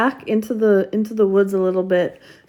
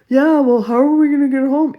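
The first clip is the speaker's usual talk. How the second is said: put-on higher, louder, fast whiny kid voice; whiny little brother; 5 semitones higher, 5 dB louder, faster than usual. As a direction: protest like a bratty little brother. Brother protests losing bike.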